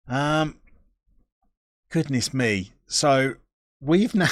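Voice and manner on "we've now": real giggles breaking off the sentence; helpless little laugh cutting the words short; giggling. Real, not acted. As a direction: start talking and dissolve into high giggles. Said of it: "Sorry, I'm giggling".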